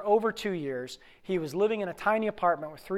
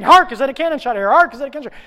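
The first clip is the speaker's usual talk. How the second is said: high, loud, rapid shouted line; screaming; 6 semitones higher, 12 dB louder, much faster than usual. Acted. Actor bursting through the audience, screaming. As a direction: yell the line through a crowd.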